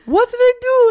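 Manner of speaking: very high, loud mock-innocent mom; hammed-up innocent mom. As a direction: squeak it like a puzzled mom.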